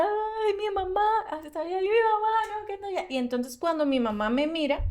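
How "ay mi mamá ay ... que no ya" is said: high, sobbing child's voice, half acted; a child crying for her mother, replayed; retold crying. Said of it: "Empecé a llorar mucho". (voz aguda de niña llorando, imitada)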